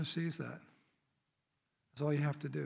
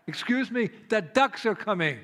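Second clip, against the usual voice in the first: higher, louder warning to drivers; insistent calling out; 6 semitones higher, 4 dB louder than usual. Retold or imitated. Man in the road warning drivers, insistent raised voice. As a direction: call it out urgently over traffic.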